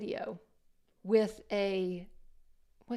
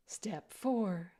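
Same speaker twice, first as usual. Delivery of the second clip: whispering. Pretend. Whispered step cue.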